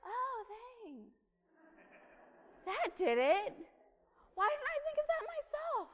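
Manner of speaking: high-pitched sarcastic thanks; mock gratitude. Stressed-out person answering the yeller, sarcastic mock thanks. Mock reply to being told calm down.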